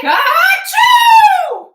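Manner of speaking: very high, loud shouted achoo; the biggest pretend sneeze yet; huge fake sneeze. Teacher acting the ant's sneeze for the children, very loud.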